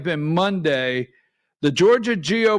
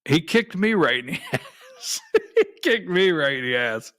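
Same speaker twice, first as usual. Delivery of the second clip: laughing through the words; laughing fondly. Real, not acted. Host laughing about his late friend, laughing fondly. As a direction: chuckle as you remember him.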